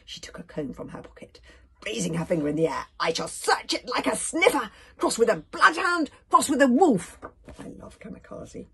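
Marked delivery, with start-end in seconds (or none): 3.0-7.2 loud, high theatrical declaration; grand boast. A narrator's reading. Kamikaze vowing to sniff out everything, grandly boastful. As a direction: build each comparison with more force.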